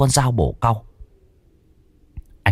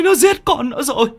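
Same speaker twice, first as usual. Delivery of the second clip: high anguished wail; bitter wailing. A narrator's reading. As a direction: cry out the horror, sobbing. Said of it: "gào lên chua xót".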